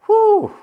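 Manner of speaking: high, loud whooped whew; mock-scared whoop of breath; exaggerated whew. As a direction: let out a big high-pitched whew. Mock relief at something scary.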